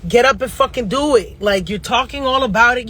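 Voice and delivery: normal talking voice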